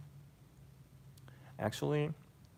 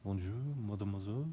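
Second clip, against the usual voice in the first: quick mock-French greeting; imitated French speaker; French accent; faster than usual. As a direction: put on an exaggerated French accent, flowing quickly. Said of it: "French sounds like this to me".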